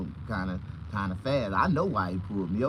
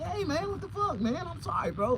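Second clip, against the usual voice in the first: high flustered apology. Retold or imitated. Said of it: "I'm like".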